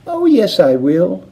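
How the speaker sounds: high little squeaky voice; the shrunken boy squeaking defiantly; tiny squeak. A narrator's reading. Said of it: "squeaked the tiny voice of Mike Teavee".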